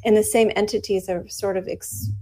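ordinary delivery

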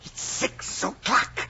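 a soft, breathless whisper; sleepy whisper. Acted. Dormouse whispering the time at the tea party. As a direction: whisper it as if half asleep.

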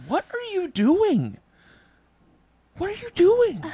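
high, loud alarmed question; shocked what are you doing; startled disbelief. Real, not acted. Reacting to weird noises.

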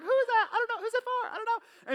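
very high-pitched mock female gossip; imitating chattering women. Women around him wondering about the sign, high mock chatter. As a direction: put on a falsetto, curious women whispering questions aloud. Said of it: "you know how women do".

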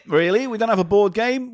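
usual speaking voice

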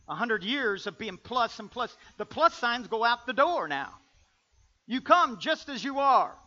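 loud preaching, climbing in pitch and force; full-force preaching. Real, not acted. Preacher telling the church to drop plus signs, full-force preaching. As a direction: build it to a loud proclamation.

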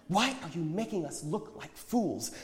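high, exasperated question; indignant challenge. A narrator's reading. Embarrassed friend challenges the prophet, indignant. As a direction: demand an explanation, pitched well up.

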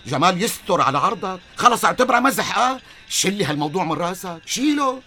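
loud, high, desperate pleading; alarmed shouting plea. Acted. Frightened friend shouting at Jamal to give up the plan, loud and high. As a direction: shout at him to drop it.

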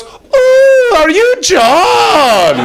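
high, loud, drawn-out Scottish squeal; put-on Scottish accent. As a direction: shriek it like an excited Scottish driver. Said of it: "I'm sorry for the accent".

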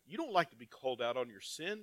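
ordinary delivery

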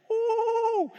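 high, hammed-up ecstatic "ooh"; mock chant cry. False prophets dancing round the altar, a high hammed-up whoop. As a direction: whoop it up like a frenzied worshipper. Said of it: "They're singing".

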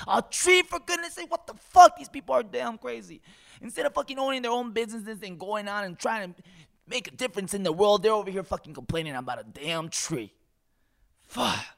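loud, high, exasperated rant; ranting about a tree fight; exasperated yelling. Real, not acted. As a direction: yell it out in disbelief.

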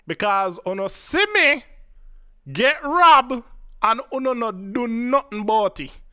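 Jamaican patois. Acted. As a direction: call them out in broad Jamaican patois. Calling out the store staff.

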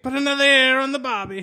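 bad Australian accent. Riffing on the ear artist in a bad Australian accent. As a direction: twist the barbie line in cartoon Aussie. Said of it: "You guys are really bad".

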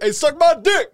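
loud, high-pitched jeer; imitated taunting yell. Imitating a player swearing at a heckler, loud high taunt. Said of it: "telling the, you know, homeboy".

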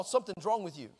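ordinary delivery

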